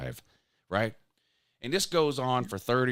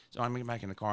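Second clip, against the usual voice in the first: usual speaking voice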